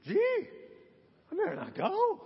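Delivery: high-pitched, mock-hesitant realization. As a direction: squeak out a second thought.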